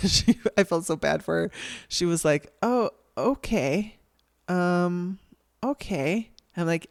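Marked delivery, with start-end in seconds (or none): 2.6-6.3 high, drawn-out, hesitant okay; taken-aback caller. Retold or imitated. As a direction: imitate an unsure caller absorbing a refusal. Imitating caller who was turned down.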